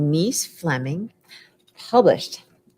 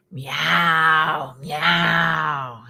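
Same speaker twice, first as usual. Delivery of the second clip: big cat meow. Acted. Teacher voicing the big tuxedo cat, a big meow. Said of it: "A big meow".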